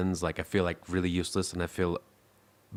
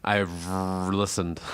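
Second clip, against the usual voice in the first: slow deadpan; much slower than usual. Pretend.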